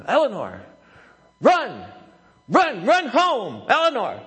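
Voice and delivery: high, loud coach's shout; reenacted sideline holler, pitch jumping up; worked-up yelling. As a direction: urgently yell a runner home. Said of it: "I'm getting a little w-, worked up".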